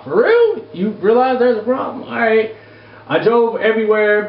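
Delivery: usual speaking voice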